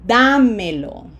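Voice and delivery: Dámelo with a loud, stretched first syllable; teacher's emphatic demo of Dámelo; long vowels, first-syllable stress. Shown as a demonstration. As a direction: punch the Dá in Dámelo, then fall away. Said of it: "Long vowels and emphasis on".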